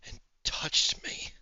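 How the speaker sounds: slow, uneasy whisper; hushed paranoia. Acted. Paranoid recluse fears being touched.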